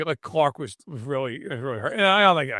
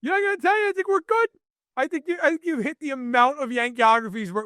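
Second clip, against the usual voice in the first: much higher, dismissive mock voice; mock network exec; 8 semitones higher than usual. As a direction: brush him off in a high squeaky parody. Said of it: "And he's like".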